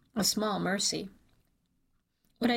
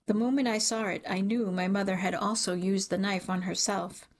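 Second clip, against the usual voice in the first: plain reading voice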